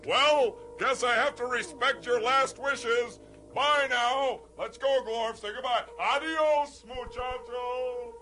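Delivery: high-pitched, theatrical hero's goodbye; hammed-up noble farewell. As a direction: bid the sidekick a grand, showy adieu. Hero playing noble farewell, abandoning sidekick.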